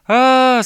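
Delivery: long, high-pitched holler; very loud drawn-out "aaah"; wild yell. A narrator's reading. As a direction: let out a sustained high cry. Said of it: "снова завопил медвежонок".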